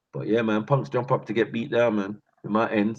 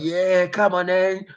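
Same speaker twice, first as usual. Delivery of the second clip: bus driver taunt; 10 semitones higher, slower than usual. Retold or imitated. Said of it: "This man got off the bus".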